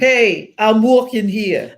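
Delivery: loud New York "Hey, I'm walking here" with a slow drawn "walking"; shouted New York accent. Shown as a demonstration. Showing friends a loud New York "Hey, I'm walking here". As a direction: yell it like an angry New York pedestrian. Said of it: "That's New York".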